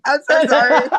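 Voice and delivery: high, loud laughing apology; giggling sorry. Real, not acted.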